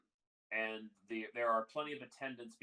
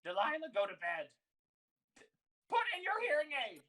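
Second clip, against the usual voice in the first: high, loud scolding, climbing higher; hollering; 13 semitones higher, 5 dB louder, faster than usual. Acted. Old sister sends Delilah to bed, hollering. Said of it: "hollering at each other".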